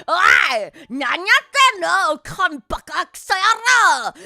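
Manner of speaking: loud, furious Japanese yelling, slow and heavy; angry drunk husband. Retold or imitated. Drunk husband scolding wife over dust, shouting. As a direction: bark at her like a drunk boss. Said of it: "And he'll be like".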